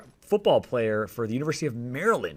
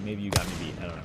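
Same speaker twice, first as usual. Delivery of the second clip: ordinary talk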